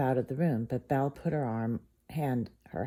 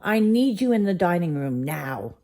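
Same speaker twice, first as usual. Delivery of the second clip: loud, higher demanding command; bellowing; 5 semitones higher, 7 dB louder than usual. A narrator's reading. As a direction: roar the order at them. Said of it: "he bellowed".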